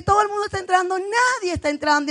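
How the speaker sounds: quick, high retort throwing the words back; exasperated retort. Retold or imitated. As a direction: contradict him fast and high-pitched. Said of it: "Y yo le decía que".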